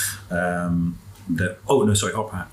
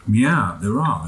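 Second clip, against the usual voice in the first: talking through a mouthful; answering mid-bite, food in mouth; mouth full; 2 semitones higher, 5 dB louder, much slower than usual. Real, not acted. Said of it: "you're trying to eat".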